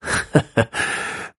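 a knowing little laugh under the breath; mysterious old puppet-maker chuckling; old man's chuckle. Acted. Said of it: "笑了一下说".

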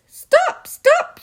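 wolf yelling. Acted. Wolf chasing pigs, shouting loud and high. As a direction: yell after the escaping pigs.

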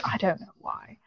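"I don't know why." whispered, no voice; whispering. Real, not acted. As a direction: breathe "I don't know why." under breath. Unvoiced repeat.

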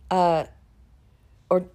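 normal talking voice

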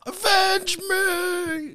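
high, slow hammed-up dying cry; overacted death scene. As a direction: stretch it out like a dying hero. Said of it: "looking at my seven-year-old son".

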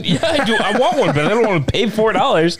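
high, giggling customer answering back; laughing mock customer. Customer answering the clerk, high and laughing. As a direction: play the customer, cracking up. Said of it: "be like".

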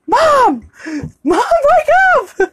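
imitated high-pitched panicked yell; Peter. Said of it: "all of a sudden Peter goes".

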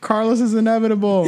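high-pitched, on the edge of laughter; amused. Real, not acted. Podcaster teasing Carlos, amused. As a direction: say it while cracking up.

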